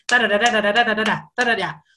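rapid babbling tongues imitation, lightly done; mock speaking in tongues. Preacher mimicking a believer who speaks in tongues, mock babble. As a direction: fake glossolalia, rattled off. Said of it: "ora en lengua".

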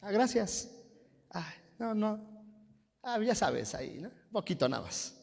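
acted coy reply to praise, softly spoken; mock bashful boasting. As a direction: play falsely modest, quietly pleased with yourself.

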